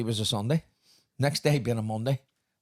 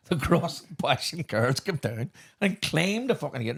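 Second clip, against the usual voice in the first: ordinary talk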